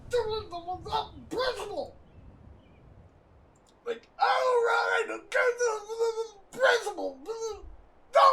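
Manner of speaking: high, crying mock sputter; sputtering teacher. Imitating the furious teacher sputtering at the student, high crying mock. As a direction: stammer you over and over, flustered.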